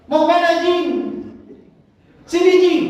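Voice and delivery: high, loud call across the house; calling out to a friend. Retold or imitated. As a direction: holler for your buddy to come over. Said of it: "Dia manggil temennya".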